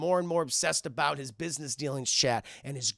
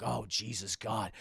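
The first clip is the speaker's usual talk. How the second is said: quiet despair; 8 semitones lower, 9 dB quieter than usual. Retold or imitated. Fred despairs quietly after rejection.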